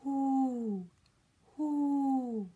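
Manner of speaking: long, breathy owl hoots; drawn-out owl call; owl hooting. Shown as a demonstration. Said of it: "An owl says".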